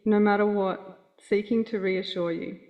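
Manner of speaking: ordinary delivery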